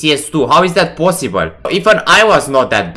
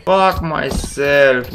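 ordinary delivery

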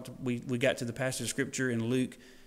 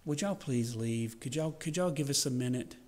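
soft, meek plea; hushed over-polite asking; mock polite request; 5 dB quieter than usual. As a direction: ask too timidly, softly. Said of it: "He didn't say".

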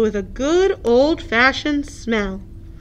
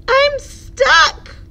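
high, louder panicked cry; alarmed cry; 14 semitones higher, 5 dB louder than usual. Acted. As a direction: cry out stuck and flustered. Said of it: "cried Hippo".

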